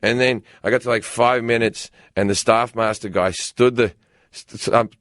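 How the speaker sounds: ordinary talk, nothing marked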